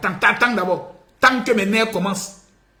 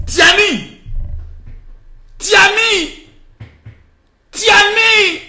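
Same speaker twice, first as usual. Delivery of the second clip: calling out; 11 semitones higher, much slower than usual. Acted. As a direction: call him across the room, building. Calling Tiani into his clinic. (appel de plus en plus fort)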